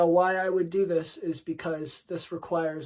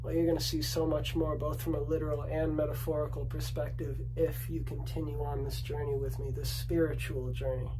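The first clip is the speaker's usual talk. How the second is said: soft seductive tease; 3 semitones lower, 8 dB quieter than usual. Shown as a demonstration. Seductive teasing on the mountain top.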